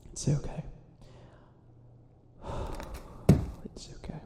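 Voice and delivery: hushed, almost breathless "it's okay"; soft self-reassurance. Acted. Villain soothing himself after a rant, hushed and soft. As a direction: talk yourself down gently.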